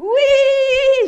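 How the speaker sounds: loud high cheering yes; joyful shout. Real, not acted. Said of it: "J'ai pas entendu".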